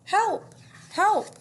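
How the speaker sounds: high, loud desperate cries for help; duck crying for help. Acted. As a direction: call out like a panicking stuck duck. Duck calling for help in story.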